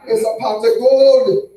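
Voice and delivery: loud, high, drawn-out mock Jamaican; Jamaican accent. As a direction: belt it out slowly in a Jamaican accent. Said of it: "Or the goddamn Jamaicans?".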